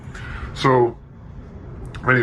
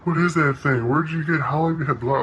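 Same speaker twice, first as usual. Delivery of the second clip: mock gushing admiration; 8 semitones higher than usual. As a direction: play a fawning fan drooling over a boat. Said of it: "dry humping this dude about his boat's like".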